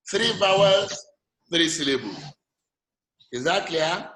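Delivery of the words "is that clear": normal talking voice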